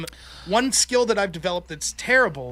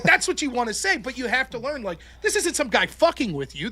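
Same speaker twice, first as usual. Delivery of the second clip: usual speaking voice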